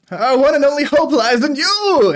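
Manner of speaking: high, loud prophetic declaration; grand proclamation. Acted. Old bard proclaiming to the crowd, high and loud.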